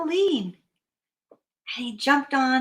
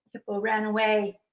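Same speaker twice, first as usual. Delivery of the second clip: ordinary delivery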